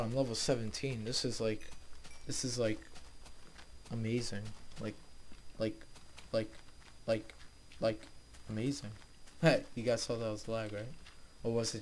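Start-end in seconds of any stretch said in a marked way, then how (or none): none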